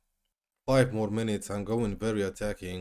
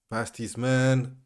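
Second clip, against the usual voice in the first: normal talking voice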